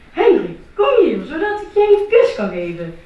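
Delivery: high, loud, gushing mum; doting mother. Acted. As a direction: coo over him like a proud mother. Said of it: "zei mama".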